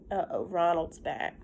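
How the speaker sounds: ordinary talk, nothing marked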